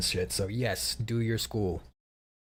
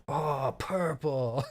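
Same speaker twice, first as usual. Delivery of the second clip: very slow, dreamy drugged pick; groggy, drugged; 2 semitones higher, much slower than usual. Retold or imitated. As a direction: let it slide out half asleep. Drugged patient picking color.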